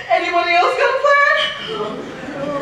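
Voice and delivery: scared lion whining for another plan; whimpering dread. Acted. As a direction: whimper to dodge the danger.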